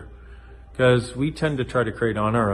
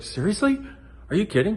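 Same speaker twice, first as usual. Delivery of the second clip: pitched higher, incredulous; mock disbelief; 9 semitones higher than usual. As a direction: react like you can't believe it. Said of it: "I was like".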